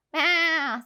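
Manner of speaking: wavering bleat; sheep bleat. Acted. Sheep answering with a wavering bleat. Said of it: "said the sheep".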